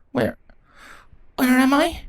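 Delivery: lost and disoriented; confused waking. Acted.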